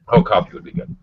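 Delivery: ordinary delivery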